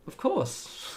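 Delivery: high-pitched mock-obvious reply; knowing retort. The rest of us reacting to Canada refusing statehood, high mock-obvious. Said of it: "the rest of us are like".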